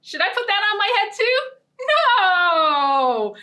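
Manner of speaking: high, fast playful teasing; silly question. Acted. Storyteller joking with the children about the scarf, high and fast. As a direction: tease them, then cry no.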